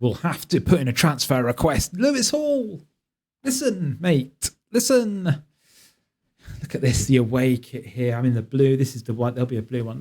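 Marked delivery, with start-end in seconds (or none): 1.9-5.4 high-pitched mock appeal, falling at each pause; drawn-out, pretend plea to a player; mock pleading. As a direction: call him to attention, half-joking. Direct appeal to the absent player.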